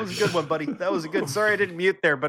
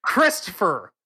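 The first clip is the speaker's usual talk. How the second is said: "six" drawn out slowly and higher; mock scolding; 7 semitones higher, much slower than usual. Teasing Christopher about saying six, slow mock scolding. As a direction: spell out the accusation slowly, teasing. Scolding friend over misheard word.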